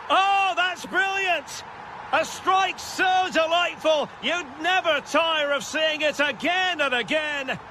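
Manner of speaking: high, exultant sports commentary; thrilled goal commentary. Real, not acted. Commentator hailing a goal of the highest order, thrilled. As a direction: marvel at the strike at full excitement.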